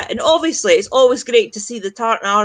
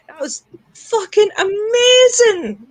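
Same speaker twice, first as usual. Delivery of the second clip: excited; 10 semitones higher, 3 dB louder, slower than usual. Real, not acted. Overjoyed about Scotland's draw.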